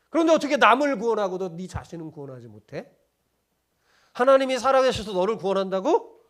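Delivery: loud, scornful jeering at the crucified man; vivid mocking. Chief priests mocking Jesus on the cross, loud and scornful. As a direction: taunt him with smug contempt. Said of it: "또 실감나게 제가 얘기하면 이런 거예요".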